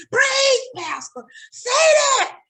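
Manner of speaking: high shouted preach pastor; church holler. Retold or imitated. Churchgoer hollering at the pastor, high shout. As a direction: egg the preacher on.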